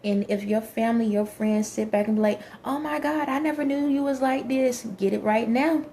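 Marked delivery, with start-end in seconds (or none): 2.6-4.9 higher-pitched mock shock, imitating relatives; mock astonishment. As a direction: play the scandalized friend, voice raised in pitch. Voicing shocked family and friends.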